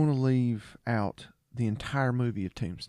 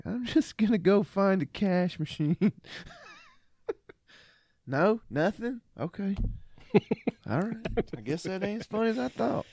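higher, goofy movie voice; higher-voiced mock Dude; 9 semitones higher than usual. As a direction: play it silly and high. Quoting the Dude's replies, laughing.

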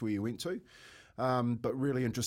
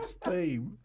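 higher drawn steam; 7 semitones higher than usual. Retold or imitated. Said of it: "as I've said".

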